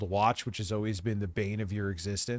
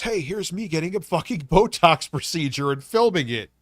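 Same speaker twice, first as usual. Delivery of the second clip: mocking loud quote; 4 semitones higher, 6 dB louder than usual. Sarcastic mock quote, loud.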